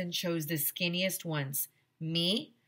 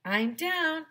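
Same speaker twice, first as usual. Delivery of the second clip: announcing arrival; 12 semitones higher, 4 dB louder than usual. A narrator's reading.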